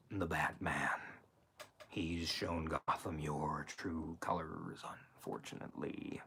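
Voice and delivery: low, soft, halting villain; the Joker. Shown as a demonstration. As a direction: keep it hushed and menacing, pausing mid-phrase. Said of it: "You want me to say that it's Jack or it's the Joker?".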